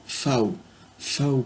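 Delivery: V said as "fao"; name V the German way, "fao"; German letter V. Shown as a demonstration. Teacher correcting himself on German V, said "fao". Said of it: "Le V se prononce comme un F".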